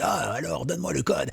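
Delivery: lightly acted high-pitched demand; mock gunman. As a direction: put on a half-playful robber's demand.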